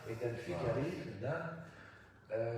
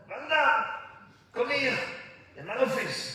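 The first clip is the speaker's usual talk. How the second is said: high, loud bossy call; imitating the boss; 12 semitones higher, 7 dB louder than usual. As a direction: summon him into the office, high and loud. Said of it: "et elle fait". (patronne qui appelle, aiguë et forte)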